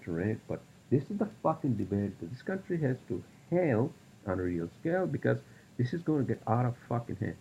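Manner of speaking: usual speaking voice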